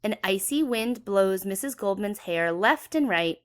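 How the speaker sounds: ordinary delivery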